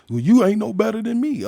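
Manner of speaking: high, loud mocking voice of a preachy critic. Mocking a churchgoer who says he is no better, high and loud. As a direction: sneer it out as the person judging him.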